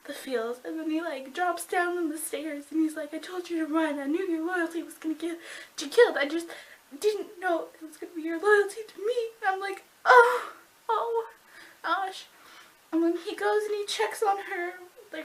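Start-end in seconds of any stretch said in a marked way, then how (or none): 3.1-9.1 high, pleading imitation of grief; mock tearful lament. Fan acting out his grief over her loyalty, high tearful voice. Acts out his grieving speech. 10.0-12.3 high, loud wailing ohs; overcome fan reaction. Retold or imitated. Fan reliving the feels of the scene, high wailing reaction.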